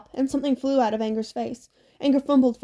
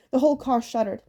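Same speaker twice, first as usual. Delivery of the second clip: plain reading voice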